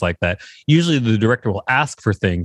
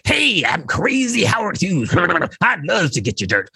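high, laughing mock eccentric tycoon; a giddy cartoon of a crazy millionaire, laughing through it; Howard Hughes impression; 6 semitones higher than usual. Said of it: "And then Howard Hughes was like".

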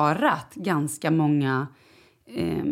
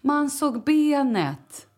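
put-on much higher, very slow kid voice; child's voice; 8 semitones higher, much slower than usual. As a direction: imitate the boy matter-of-factly. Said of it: "Och Charlie bara".

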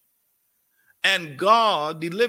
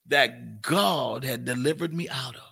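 usual speaking voice

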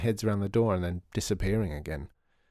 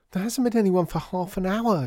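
high, distraught wail, louder; 10 semitones higher, 4 dB louder than usual. A narrator's reading. Ivy to Lydia about empty shop, high distraught wail. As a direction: wail it out in despair. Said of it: "she wailed".

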